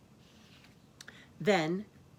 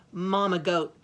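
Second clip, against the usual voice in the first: ordinary delivery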